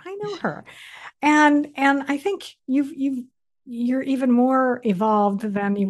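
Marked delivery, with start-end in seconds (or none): 0.0-0.6 much higher and softer, an excited echo; delighted recognition. Retold or imitated. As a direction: repeat it gleefully as it dawns. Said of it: "I'm like".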